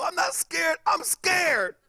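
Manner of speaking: high, whiny frightened voice; protest, then crumble into fear; acted panic. Kid answering his friend's taunt, high and scared.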